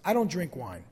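ordinary delivery

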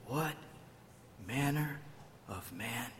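hushed, halting low murmur; awed mumble. Acted. Disciples mumbling to one another in fear, hushed. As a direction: murmur it word by word, very softly. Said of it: "mumble to one another".

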